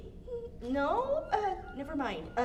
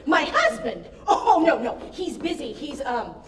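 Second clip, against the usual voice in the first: flustered panic; 3 semitones higher, 12 dB louder than usual. Acted. Panicked excuse to lover.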